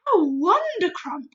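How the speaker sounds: slow, loud delighted cry; beaming cry. Acted. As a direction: exclaim it with joy. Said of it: "cried the B F G, still beaming".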